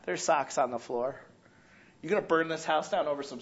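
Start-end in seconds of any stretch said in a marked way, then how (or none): 0.0-1.2 softer, lower "There's socks on the floor"; calmer take. Shown as a demonstration. Pastor has member repeat the socks complaint, now calm and soft. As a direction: say it calmly this time. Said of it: "One more time".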